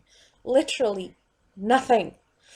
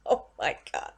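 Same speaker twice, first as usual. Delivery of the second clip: ordinary talk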